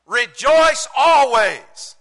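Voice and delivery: slow, high, very loud preaching; emphatic proclamation. Real, not acted. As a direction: proclaim it to the whole congregation. Preacher proclaims verse as upgrade.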